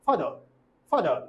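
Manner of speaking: ফাদার with no R vowel; ফাদার said quickly as fa-dr; quick syllabic R. Shown as a demonstration. Teacher demonstrating ফাদার said quickly. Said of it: "খুব দ্রুত করতে হয়".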